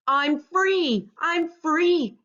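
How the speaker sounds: high, loud cry of relief; joyful exclaim. A narrator's reading. Freed crab exclaims with joy, high and loud. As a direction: burst out free. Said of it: "exclaimed King".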